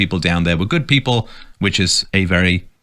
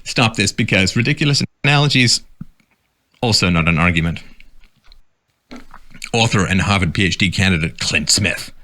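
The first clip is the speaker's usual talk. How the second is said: ordinary talk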